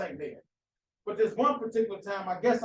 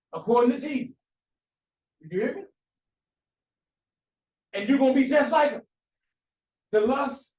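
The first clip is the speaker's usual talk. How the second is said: louder, higher, hammering each phrase home; preaching at full force; 4 semitones higher, 6 dB louder than usual. Acted. Preacher driving the point home to his congregation, full-force preaching. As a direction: preach it hard to the room.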